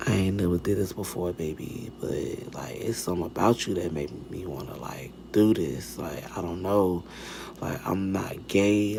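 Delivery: very low, near-whispered impression of a smitten man; boy's deep, slow sweet talk. Man admitting his attraction to another, imitated deep hushed voice. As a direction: sink into a soft, deep murmur, imitating a guy's pillow talk. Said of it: "deep, like, slow voice".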